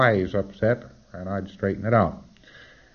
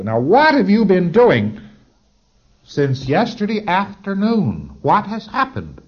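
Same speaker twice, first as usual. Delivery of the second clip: higher, louder, slow insistent auditor demand; pressing auditor; 10 semitones higher, 6 dB louder, slower than usual. Retold or imitated. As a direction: press the PC hard for an answer, slowly.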